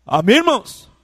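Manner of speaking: high, loud, sing-song preacher's cry; preaching at full force. Acted. Pastor exhorting the congregation over music, fervent high loud preaching. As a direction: lift the whole church with a fervent, chanted appeal.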